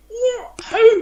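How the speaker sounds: very high recreated disbelief; astonished squeak. Retold or imitated. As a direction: squeak it in mock shock. Retelling stunned reaction.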